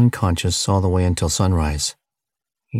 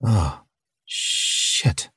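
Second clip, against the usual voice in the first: hushed, breathy gasp of shock; stunned, voiceless exhale; 3 semitones higher than usual. A narrator's reading. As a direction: let the swear escape on a breath. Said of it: "he gasped".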